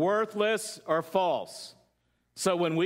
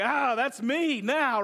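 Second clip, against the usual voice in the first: proud joke; 5 semitones higher, slower than usual. Retold or imitated. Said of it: "I'm like".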